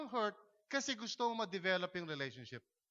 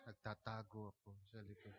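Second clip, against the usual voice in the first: much lower, much softer, half-laughing; low mumble with a laugh; 10 semitones lower, 15 dB quieter than usual. Retold or imitated. Silent churchgoer admits hiding at the back, low shy mumble. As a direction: admit it sheepishly under your breath.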